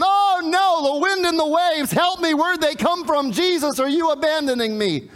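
high, loud wail of alarm, easing slightly; panicked plea. Acted. Preacher voices wavering faith begging Jesus for help, panicked plea. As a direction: cry out as if being abandoned. Acts faltering faith amid wind and waves.

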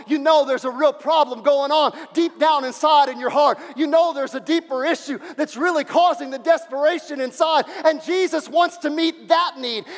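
loud, high climax of the sermon; preaching at full force. Acted. Pastor preaching loud to the congregation, passionate. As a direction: build to a passionate shout.

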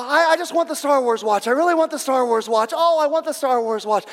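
put-on high, loud, insistent kid begging; pleading little boy. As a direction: keep nagging like a small child. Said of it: "like the little boy at Christmas time".

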